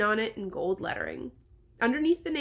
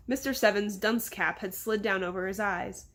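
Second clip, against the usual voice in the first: ordinary delivery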